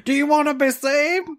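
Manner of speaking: high preacher plea; preacher question. Retold or imitated. Preacher asks if he wants saving, high. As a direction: ask it like a revival preacher. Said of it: "the guy go".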